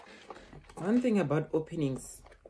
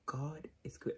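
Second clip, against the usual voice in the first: whispered, holding back tears; tearful whisper; 10 dB quieter than usual. Real, not acted. Vlogger whispering thanks, close to crying. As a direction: breathe it out through tears. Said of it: "I don't wanna cry".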